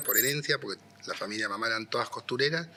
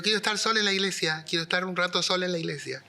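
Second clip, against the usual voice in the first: retold insistence, higher and quicker; raised pitch, politely insisting; insistent repeat; 7 semitones higher, faster than usual. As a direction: press the request on a baffled listener. Repeats request to astonished secretary.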